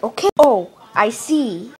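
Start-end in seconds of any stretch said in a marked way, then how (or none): none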